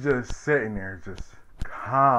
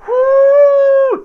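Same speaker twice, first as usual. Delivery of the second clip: very high, very loud cheering whoo; loud whoop; 16 dB louder than usual. Retold or imitated. The family celebrating the verdict with a loud, high whoop. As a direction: scream it out in triumph. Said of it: "the family's like".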